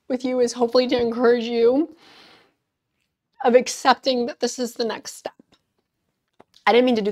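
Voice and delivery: high, emotional, holding back crying; tearful. Real, not acted. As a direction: push on through the tears. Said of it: "crying on camera".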